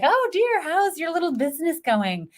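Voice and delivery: put-on high, sugary old aunt voice; sweet elderly aunt. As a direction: coo it like a prying old aunt. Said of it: "Aunt Mildred comes up and she's like".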